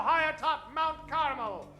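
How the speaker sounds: ordinary talk, nothing marked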